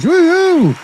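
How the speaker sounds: high, loud whoop; cheering woohoo. Real, not acted. Cheer reading superchat.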